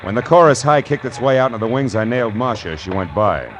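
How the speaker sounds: wisecracking private-eye storytelling, punched along; hard-boiled detective narration. A narrator's reading.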